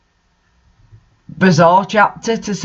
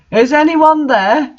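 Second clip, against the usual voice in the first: hammed-up yell, much higher; mock yell; 9 semitones higher than usual. A narrator's reading. Janitor calls into the dark, mock yell. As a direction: call out like a parody janitor. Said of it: "yelled Mister Norris".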